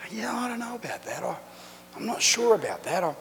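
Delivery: a touch of polite reluctance, imitating an uneasy listener; hesitant churchgoer.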